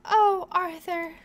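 high, loud, drawn-out swoon; swooning wife. Acted. Wife to husband after he agrees to a baby, swooning. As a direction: gush it like a smitten wife. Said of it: "She's like".

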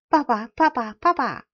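loud, rising happy calls to papa; joyful calling. A narrator's reading. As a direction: cry out to papa with delight. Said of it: "小木偶高兴极了".

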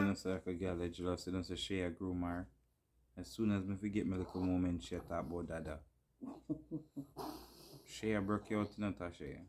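low-voiced gossip; hushed gossip. Real, not acted. Gossiping quietly off-mic.

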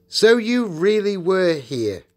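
high, loud, slow indignation; acted shocked accusation; accusing. A narrator's reading. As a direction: confront a liar with dawning outrage. Peter catches Edmund lying.

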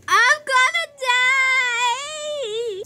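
high, very slow mock bawling; melodramatic wail. Hurt child despairs as teacher calls nurse, wailing. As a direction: stretch it into a hammed-up cry.

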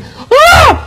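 put-on a child-show demo shout. Shown as a demonstration. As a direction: belt out a big "Α!" to make it grow. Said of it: "πρέπει να φωνάζει".

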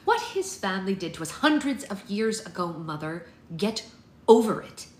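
heated rebuke, building to loud; exasperated. A narrator's reading. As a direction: argue back, then punch the last words. Daughter rebukes mother in quarrel.

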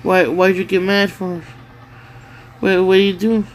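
usual speaking voice

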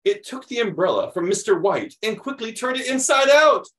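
animated picture-book reading; reading to children. Acted. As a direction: read it like story time for kids. Reads picture book aloud.

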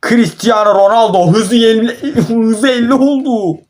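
high, drawn-out words breaking into laughter; laughing to tears. Real, not acted. Gamer in disbelief at Ronaldo's speed, laughing to tears. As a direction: squeal it out through helpless laughter. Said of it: "gözümden çok pis yaş geliyor".

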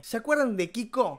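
ordinary talk, nothing marked

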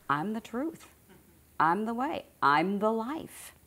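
lifted, emphatic affirmation, phrase by phrase; said like you mean it. Shown as a demonstration. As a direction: declare "I'm the life" slowly, with conviction. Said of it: "really say it like you mean it".

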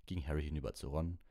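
ordinary delivery, nothing marked

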